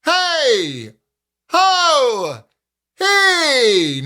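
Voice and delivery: high projected call, not pressed. Shown as a demonstration. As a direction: call across a parking lot, not yelling. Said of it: "calling out to someone across a parking lot".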